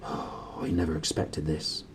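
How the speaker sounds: quietly, full of worry; low, worried. A narrator's reading. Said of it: "in a low, worried voice".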